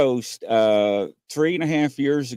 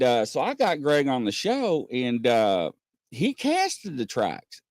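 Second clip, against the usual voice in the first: ordinary delivery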